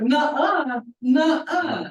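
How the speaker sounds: high, loud mock refusal; emphatic refusal. Retelling the sister's response to the trust, emphatic refusal.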